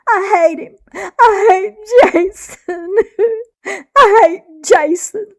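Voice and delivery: high, loud sobbing wails; crying rage. A narrator's reading. Heartbroken girl sobbing to her mother, wailing. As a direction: wail and sob the words out.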